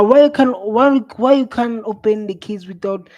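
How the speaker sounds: ordinary talk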